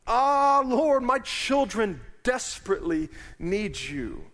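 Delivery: a desperate listener calling to God; anguished cry. Retold or imitated. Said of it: "you're saying".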